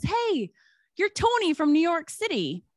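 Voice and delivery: imitated calling out, pitched well up; retold shout. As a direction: call out to a stranger, retelling it. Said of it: "shouts out to this guy and goes".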